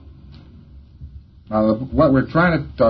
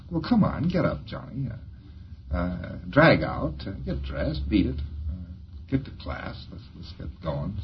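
put-on sleepy father waking kid; 3 semitones lower, 9 dB quieter than usual. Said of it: "attitude toward that possibly Pop has".

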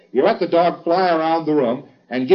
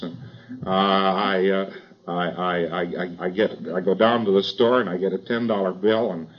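low, soft, stammering complainer; hapless unlucky fellow; 5 semitones lower, 4 dB quieter than usual. Acted. Unlucky man telling the auditor his misfortunes, hapless and halting. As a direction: recite your woes haltingly in a low, subdued voice.

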